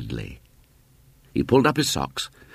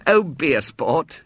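put-on slow, higher boyish plea; pleading; 7 semitones higher, slower than usual. A narrator's reading. Boy pleading with William, slow and higher. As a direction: beg him nicely. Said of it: "he pleaded".

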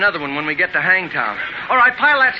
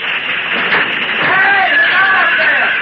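sudden high alarmed shout; shouting a warning; 11 semitones higher, 4 dB louder, much slower than usual. Acted. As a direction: yell to stop them at the cliff edge. Warning wagon about to go over cliff.

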